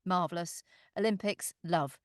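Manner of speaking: ordinary talk, nothing marked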